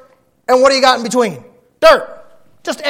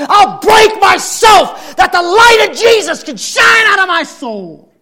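shouted prayer; 12 semitones higher, 12 dB louder than usual. Acted. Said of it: "To say".

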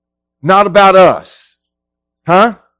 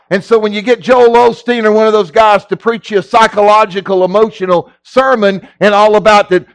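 ordinary delivery

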